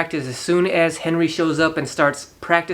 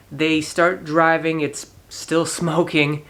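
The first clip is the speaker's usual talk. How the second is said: normal talking voice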